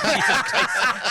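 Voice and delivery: 笑着不敢相信; laughing disbelief. Real, not acted.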